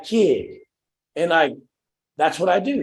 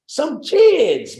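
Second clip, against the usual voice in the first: excited; 12 semitones higher, 6 dB louder than usual. Real, not acted. Coach marveling to the call about the kids, loud and high.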